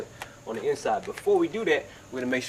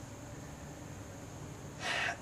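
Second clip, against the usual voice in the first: a real rough throat clear; 13 dB quieter than usual. Real, not acted. Tint tutor apologizing for his cold, real throat clear. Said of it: "please excuse me".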